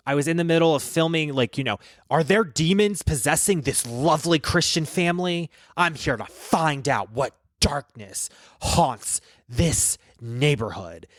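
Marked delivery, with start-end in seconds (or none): 2.1-11.0 slow, portentous ghost-show host; Zach Bagans. Acted. Host investigating demons in a family's neighborhood, portentous impression. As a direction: space out the words for dramatic weight, slowing to the end. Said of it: "pretended to be him".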